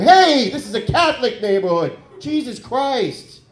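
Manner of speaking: high, loud indignant yell; screaming. Retold or imitated. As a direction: yell angrily at the trees. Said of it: "I just start screaming at him".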